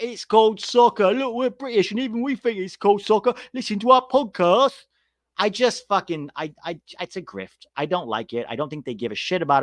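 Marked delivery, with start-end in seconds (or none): 0.0-4.9 high mock British accent; mock British podcasters. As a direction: lampoon two Brits pandering in a put-on British voice. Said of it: "It's like".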